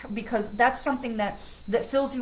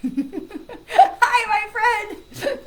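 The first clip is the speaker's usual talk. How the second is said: laughing; 12 semitones higher, 8 dB louder, much slower than usual. Real, not acted. Greeting friend with laughter.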